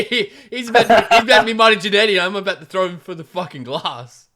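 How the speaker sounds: high, loud, laughing rant; mock outrage. Player threatening to throw the shopkeeper, mock outrage.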